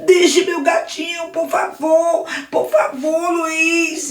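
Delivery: high, frightened begging; Daniel begging Luiz to spare his cat, high voice; worried pleading child. Acted. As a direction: beg for the kitten. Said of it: "Daniel ficou preocupado e disse".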